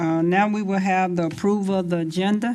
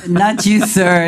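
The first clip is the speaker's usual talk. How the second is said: louder emphatic repeat; 11 dB louder than usual. Real, not acted. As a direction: call it out clearly over the room. Mayor repeats correction louder.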